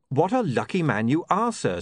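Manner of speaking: straight-faced, grave delivery; solemnly. A narrator's reading. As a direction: keep a dead straight face. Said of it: "said William solemnly".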